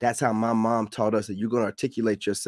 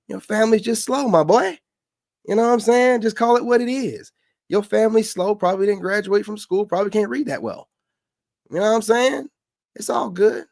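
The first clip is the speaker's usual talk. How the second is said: mocking taunt; 11 semitones higher, 3 dB louder than usual. Real, not acted. Mocking the northerner's slow family.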